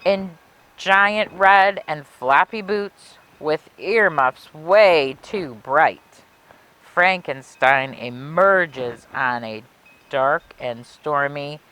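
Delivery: put-on lilting rhymed read-aloud for children; storybook narration. A narrator's reading. Librarian reading a Halloween rhyme to kids, storybook lilt. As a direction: savor each rhyme like a spooky bedtime tale.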